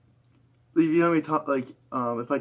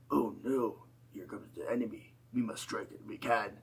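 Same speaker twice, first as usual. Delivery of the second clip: character voice; 7 dB quieter than usual. Shown as a demonstration. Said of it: "fun doing, like, a lot of voices for characters".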